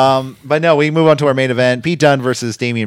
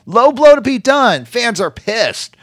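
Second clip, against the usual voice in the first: high, drawn-out announcer-like call; slow right down and hit the big moment; dramatic recap; 10 semitones higher, 4 dB louder, slower than usual. Real, not acted. Dramatic climax of match recap.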